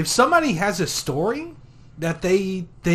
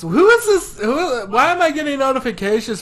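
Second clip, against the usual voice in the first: mock surprise; 7 semitones higher, 4 dB louder than usual. Feigned confusion.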